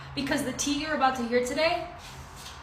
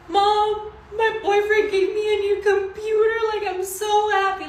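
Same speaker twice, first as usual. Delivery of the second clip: much higher, bursting with happy news; telling mom about the new computer, thrilled and pitched way up; overjoyed gushing; 10 semitones higher, 7 dB louder than usual. Retold or imitated. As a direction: blurt it out to mom like you can't contain it. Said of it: "I was literally crying".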